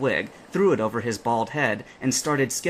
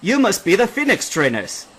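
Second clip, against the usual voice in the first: higher, louder hearty greeting; captain's greeting; 8 semitones higher, 7 dB louder than usual. A narrator's reading. The captain welcoming the trainers, hearty greeting. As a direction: call it out warmly.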